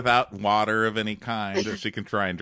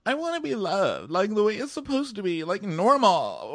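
high, weepy put-on girl voice; mock sobbing Corinne; 11 semitones higher than usual. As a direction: whimper out the heartbreak. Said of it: "But she goes".

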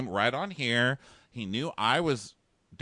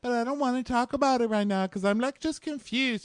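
very high put-on voice; squeaky mock-helpless protest; mock girly voice; 13 semitones higher than usual. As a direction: imitate a girlfriend begging off a talk. Voicing Amanda refusing to talk.

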